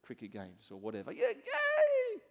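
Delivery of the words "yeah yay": very high, loud cheer; piercingly high mock cheer; screaming sideline parent. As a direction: imitate a parent yelling from the sidelines. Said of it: "you're screaming".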